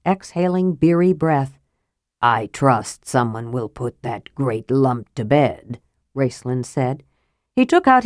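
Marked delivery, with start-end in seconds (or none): 2.2-5.8 low, slow mage voice; disdainful mage. A narrator's reading. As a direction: draw it out low in quiet disdain. Raistlin disdains his drunken twin.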